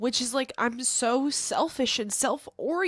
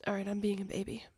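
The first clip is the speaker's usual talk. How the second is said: whispered, softer self-talk; 4 semitones lower, 7 dB quieter, faster than usual. Real, not acted. She whispers to herself, trying to calm down. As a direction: murmur it to yourself without voice. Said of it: "self-talk whispered".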